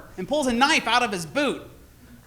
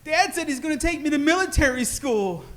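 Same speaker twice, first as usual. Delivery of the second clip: put-on kid voice; 6 semitones higher than usual. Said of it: "I was like".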